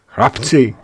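put-on Anči crying out to the boys; exclaiming. A narrator's reading. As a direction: call out to the boys. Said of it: "vykřikla Anči".

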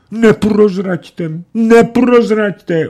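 high, slow, drawn-out moan; wailing plea. A narrator's reading. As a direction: wail for mercy. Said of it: "úpěl". (úpěnlivě naříkat)

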